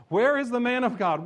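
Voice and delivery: loud and high, hammering WHERE; preaching shout. Acted. Preacher shouting the student's question aloud. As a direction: cry it out to the crowd.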